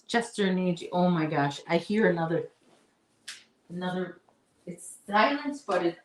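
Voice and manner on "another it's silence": usual speaking voice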